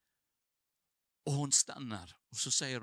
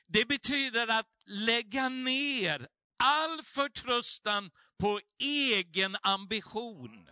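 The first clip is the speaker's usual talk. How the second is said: louder, higher sermon build, urging the congregation; full-force preaching; 6 semitones higher, 8 dB louder than usual. Acted. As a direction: deliver it like a revival preacher calling to the cross.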